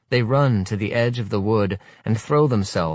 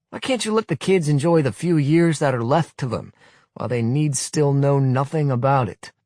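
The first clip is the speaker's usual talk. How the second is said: higher, louder sarcastic tirade; scornful rebuke; 7 semitones higher, 4 dB louder than usual. Acted. Willy scolding the youth leader, scornful tirade. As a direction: tear into him with scorn.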